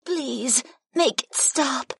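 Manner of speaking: high, slow, distressed female voice; high-pitched desperate plea; pleading. A narrator's reading. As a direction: beg for it to stop. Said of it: "Keela pleaded with me".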